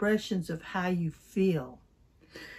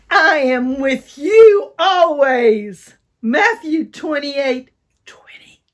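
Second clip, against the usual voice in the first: loud, higher, slow excited shout; 5 semitones higher, 11 dB louder, slower than usual. Shown as a demonstration. Teacher leading an excited recital. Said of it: "really get excited".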